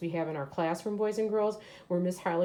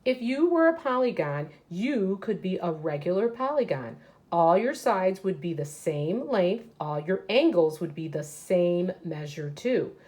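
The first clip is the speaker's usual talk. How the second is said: put-on reading aloud to children; 5 dB louder than usual. Teacher reading a picture book to her class, lively read-aloud. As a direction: read it out big and lively for the kids.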